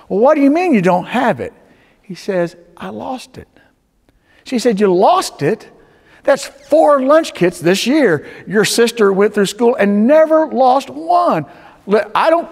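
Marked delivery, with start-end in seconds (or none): 0.1-1.5 quick, loud, higher-pitched demand; acted exasperated mother scolding; yelling mother. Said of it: "and she's yelling". 2.8-3.5 put-on small, high, soft child voice; acted timid little-boy admission; meek confession. Boy confesses to scolding mother. 4.8-12.5 loud, high-pitched scolding; acted outraged mother; yelling mother. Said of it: "and she's yelling".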